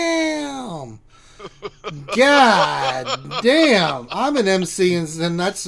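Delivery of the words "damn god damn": drawn-out, swooping exclamation; astonished, long-vowelled swear; stunned cursing. Real, not acted. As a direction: stretch both words out in amazement. Stunned reaction to the question.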